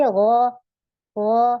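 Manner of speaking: गौ as a loud, thick gho from the throat; heavy ghain. Shown as a demonstration. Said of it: "हमने जब मोटा बनाना है".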